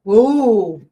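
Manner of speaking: startled whoa. Real, not acted. Pin hit while sewing.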